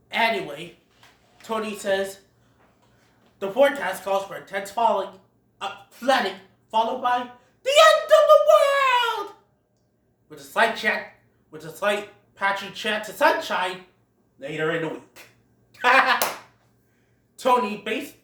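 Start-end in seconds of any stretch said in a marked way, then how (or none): 3.4-15.2 mock forecast. Tony doing doomsday forecast.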